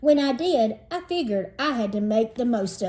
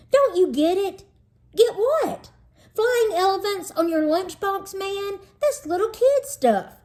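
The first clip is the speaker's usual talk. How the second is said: mock exasperation; 10 semitones higher than usual. Joey scornful about lunchbox.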